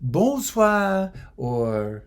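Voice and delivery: put-on lightly French-accented shop woman, slow and patient; French pharmacist. French pharmacist teaching Carol the greeting, slow French lilt. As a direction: school the tourists gently in a French lilt. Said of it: "the woman says".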